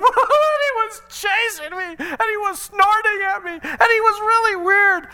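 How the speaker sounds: put-on high, slow, sobbing child voice; crying boy. Son imitated crying to his mom about the weird guy, high and sobbing. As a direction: wail it like a scared eleven-year-old. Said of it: "He's like".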